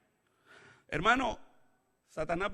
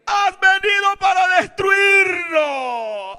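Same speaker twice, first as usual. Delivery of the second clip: very high, drawn-out wailing shout; demon crying out; 14 dB louder, much slower than usual. Acted. Demon afraid of being destroyed by Jesus, crying out. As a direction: stretch the scream to the end. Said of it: "Y dio voces".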